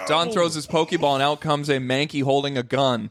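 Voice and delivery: ordinary talk, nothing marked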